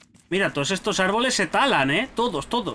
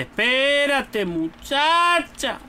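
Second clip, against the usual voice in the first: drawn-out; 8 semitones higher, much slower than usual. Real, not acted. Waiting for something in game.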